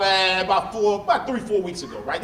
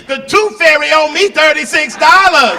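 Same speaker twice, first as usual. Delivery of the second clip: loud mock child demand; loud laughing kid voice; 6 semitones higher, 9 dB louder than usual. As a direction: bellow the kid's claim through a laugh. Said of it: "and come tell me".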